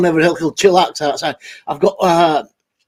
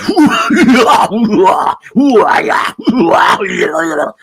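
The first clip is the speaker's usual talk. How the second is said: Sid James cackle; 2 semitones higher than usual. Retold or imitated. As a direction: cackle like a lecherous old comic. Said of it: "that Sid James were all".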